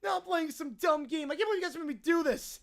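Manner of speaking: put-on bratty child rattling off grievances; petulant, dragged-out little-kid complaining; whiny kid. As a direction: whine like a kid mid-tantrum. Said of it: "peak whiny kid territory".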